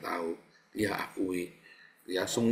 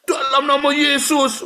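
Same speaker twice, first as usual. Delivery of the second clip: much higher, loud, slow cry; mock rebuke shout; 14 dB louder than usual. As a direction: bellow a mock exorcism.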